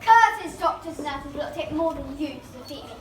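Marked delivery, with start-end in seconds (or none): 0.0-0.6 foiled villain. Acted.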